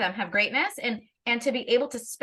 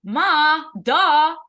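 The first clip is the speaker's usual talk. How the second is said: loud, higher eye-roll duh; mock exasperated kid; 6 semitones higher, 10 dB louder than usual. Kids telling their mother she is obvious, a loud mock duh. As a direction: belt out the kid's bored put-down. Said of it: "They're like".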